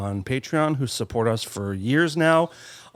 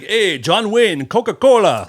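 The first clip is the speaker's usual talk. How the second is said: hammed-up Americana guys; 6 semitones higher, 7 dB louder than usual. Host imitating two men faking Americana, hammed-up and loud. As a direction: holler it like wannabe Americans, stretched out. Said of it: "They're like".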